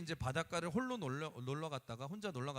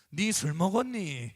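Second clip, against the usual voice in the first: loud, slow, baffled dad; gruff father; 2 semitones higher, 11 dB louder, slower than usual. Retold or imitated. Father answering son's tearful call, loud and slow. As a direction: demand it like a stunned father.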